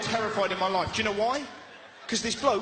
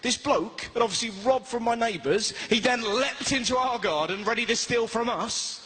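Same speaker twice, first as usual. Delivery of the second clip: ordinary talk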